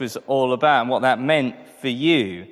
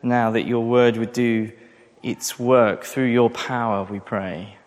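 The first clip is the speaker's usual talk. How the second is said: prayer; 4 semitones lower than usual. Acted. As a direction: pray humbly to God before the sermon. Pastor prays before the sermon.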